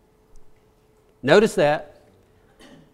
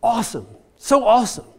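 put-on kids' slang; 4 semitones higher, faster than usual. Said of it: "they'd go".

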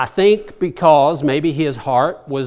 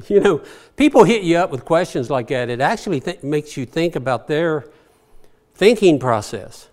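ordinary talk, nothing marked

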